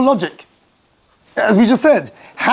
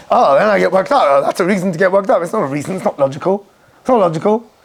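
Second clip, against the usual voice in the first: ordinary delivery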